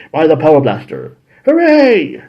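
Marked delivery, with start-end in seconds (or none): 1.4-2.2 high cartoon cheer; mock cheer. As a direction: cheer like a kid watching. Villain defeated, cheering.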